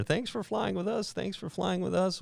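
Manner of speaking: high, soft mock flight-crew patter; mimicked airline goodbye, lifted and light; imitated crew farewell. Crew's rote farewell to passengers.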